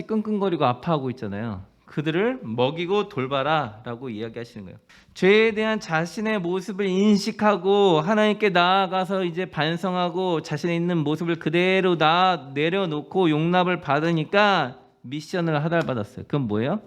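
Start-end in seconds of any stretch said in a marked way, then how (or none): 5.1-16.3 louder, slower sermon delivery; fervent preaching. Real, not acted. Pastor urging the congregation, fervent preaching. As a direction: drive the message home from the pulpit.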